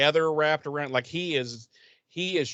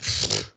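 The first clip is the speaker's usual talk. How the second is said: dismissive scoff. Retold or imitated. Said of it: "And she's like".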